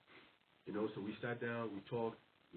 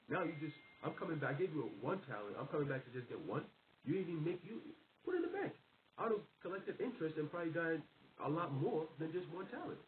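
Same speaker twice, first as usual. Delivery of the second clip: a raised-pitch, lightly voiced master scolding his servant; 5 semitones higher than usual. Master scolds the lazy servant for not banking the talent, raised-pitch mock scolding. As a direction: let the disappointed master scold.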